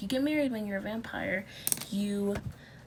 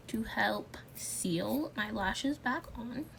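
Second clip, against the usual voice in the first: whispering; 4 dB quieter, slower than usual. Real, not acted. Whispered asides while applying.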